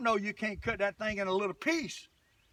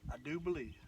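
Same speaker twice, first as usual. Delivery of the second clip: much softer and lower; quiet echo; 6 semitones lower, 10 dB quieter than usual. Real, not acted. Angler repeating his theory to himself, quiet and low. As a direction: drop it to a low aside.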